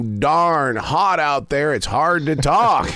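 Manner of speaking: slow, stretched-out Southern drawl, as if the heat makes talking hard. Retold or imitated. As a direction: drag the words out like a lazy Southern drawl. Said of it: "where does a Southern accent come from".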